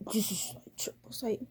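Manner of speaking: breathy whispered aside. Real, not acted. Vlogger ranting at reviewers, whispers an exasperated aside. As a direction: drop to a breathy whisper mid-rant.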